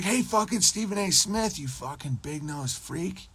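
high jeer dropping to a soft sneer; mock taunt. Taunting Stephen A Smith, high jeer. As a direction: call out high then hiss the insult. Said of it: "I'd be like".

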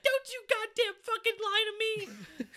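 very high, loud mock screaming; high-pitched screamed scolding parody; imitating enraged mom. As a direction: shriek it like a furious caricature mother. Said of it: "And she's like".

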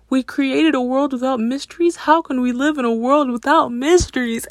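high, dismayed mock lament; hammed-up despair; playful mock upset; upset Velma. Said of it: "And Velma's so upset".

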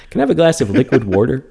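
mock request with "water" said "warter"; Neil deGrasse Tyson. Joking at home, asking for a glass, "water" said as "wawr-ter". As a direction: put an r before the t in "water". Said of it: "we always say".

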